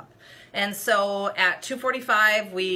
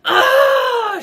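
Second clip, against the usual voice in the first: very high, loud scoffing oh; indignant oh; 13 dB louder than usual. Retold or imitated. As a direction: throw it out like an offended huff. Volunteer's indignant retort.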